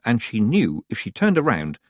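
ordinary delivery, nothing marked

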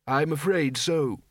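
slow, put-on elderly voice; lightly regretful elder, drawn out; apologetic old wizard. Undead wizard to sergeant, apologetic.

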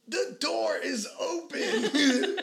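high, drawn-out alarmed yell, half laughing; retold yelp of alarm, stretched out high; panicked cry. Friend retelling being grabbed into a car, panicked cry.

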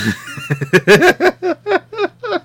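high, loud burst of laughter; real laughter. Real, not acted. Host left with one co-host cracks up, real laughter. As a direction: break up in a long, high laugh.

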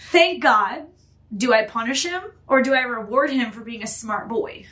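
ordinary talk

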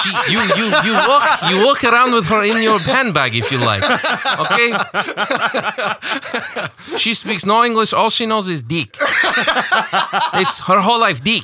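high mock Ukrainian accent, laughing; hammed-up Eastern European seller. Pitching to a customer in mock Ukrainian accent. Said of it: "laughing at the Ukrainian accent".

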